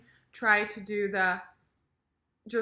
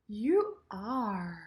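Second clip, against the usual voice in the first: "are" full and falling; stated "You are". Shown as a demonstration. Teacher models the statement for learners, falling "are". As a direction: confirm it, letting "are" drop. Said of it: "sentences and questions".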